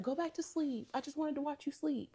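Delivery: high, hushed imitation of her; small, soft put-on voice; softly voiced girlfriend. Voices the girlfriend watching him sleep.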